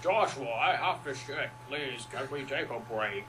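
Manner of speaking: slow, odd put-on voice of a whiny creature; lightly voiced beast begging for a break; talking pet creature. Pet creature asking Joshua for a toilet stop, lightly voiced. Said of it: "it would be talking like this".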